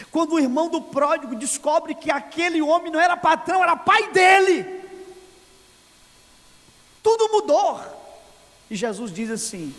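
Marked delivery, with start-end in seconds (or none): 0.1-7.8 rising from strong to shouted, slowing on era pai dele; building preaching cry. Real, not acted. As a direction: climb until you are crying it out. (pregação crescendo até o grito)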